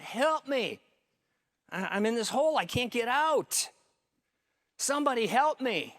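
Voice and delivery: high, urgent plea from below; calling for help. Acted. Man stuck in a hole to passer-by, calling out desperately. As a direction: plead upward, pitch leaping on each call. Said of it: "the guy goes one more time".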